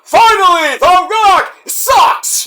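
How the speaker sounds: loud, high, slow shouted catchphrase; a slow, high, loud wrestling shout; mock Rock shout. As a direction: bellow it like a wrestler to the crowd. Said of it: "just say".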